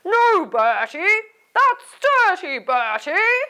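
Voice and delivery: loud, put-on scolding dad. Dad telling Bertie off for picking his nose, scolding. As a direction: boom it out like a cross dad. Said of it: "Can you do it in a dad voice".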